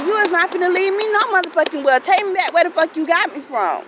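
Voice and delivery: high, loud furious retort; shouting at the aunt over money; angry yelling. Retold or imitated. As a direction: yell it back at her, fed up. Retold fight in the car.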